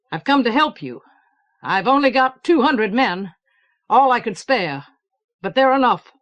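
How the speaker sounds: steady, resolute commander; firm soldier voice. Acted. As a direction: pledge help with firm resolve. General pledges his men.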